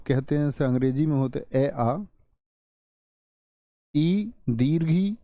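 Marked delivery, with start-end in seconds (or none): 4.0-5.1 ई दीर्घ ई drawn out slowly, each vowel held; slow vowel demo. Shown as a demonstration. Teacher to class, slowly sounding out long ई. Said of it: "ये बड़ी ई होती है".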